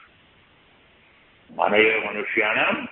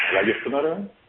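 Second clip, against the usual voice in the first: ordinary delivery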